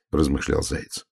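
ordinary delivery, nothing marked

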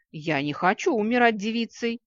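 loud, high, aggrieved wail; hammed-up indignant lament; wailing protest. A narrator's reading. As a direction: complain like a woman passed over. Left-out lady loudly protests.

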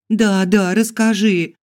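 loud, high, excited chiming in; eager urging. A narrator's reading. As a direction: urge her on eagerly. Girls chime in eagerly. (взволнованно, наперебой упрашивая)

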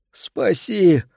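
high, dry-throated plea for rescue; hoarse cry. A narrator's reading. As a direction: rasp out a desperate cry. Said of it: "Слова царапали пересохшее горло".

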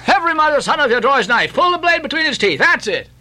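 high, fast rallying orders; Stubb urging. A narrator's reading. Stubb to his crew with knife drawn, urging fast. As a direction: rattle off the order to your crew.

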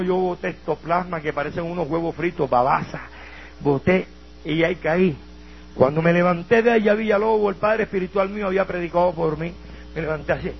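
ordinary talk, nothing marked

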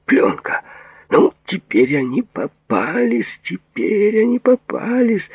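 high, drawn-out gloating cry; triumphant. Acted. Patient gloats that plotters are caught on tape, triumphant. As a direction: crow over trapped enemies, stretching it out high.

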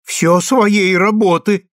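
put-on high, slow proud old woman. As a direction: say it with a grandmother's pride. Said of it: "с гордостью сказала старушка".

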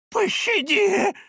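high, drawn-out plea for mercy; desperate begging. A narrator's reading. Old man begging the demon for mercy, pleading desperately. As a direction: beg for your life, high and slow. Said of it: "взмолился Халерун".

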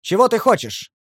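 loud, high call through the wall; challenging shout, stalling for time; shouted reply. A narrator's reading. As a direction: holler back to the enemy outside. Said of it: "крикнул я в ответ".

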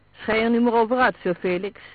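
a loud, commanding housekeeper's voice; heavy housekeeper voice. A narrator's reading. Housekeeper orders Sir Felix to stop, loud and commanding. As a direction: boom it out like a stern housekeeper. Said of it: "zei de diepe, zware stem van de huishoudster".

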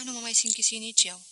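usual speaking voice

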